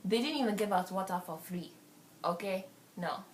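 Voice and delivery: lightly put-on African accent. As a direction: tell the party story in an African accent. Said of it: "I'm gonna go to African".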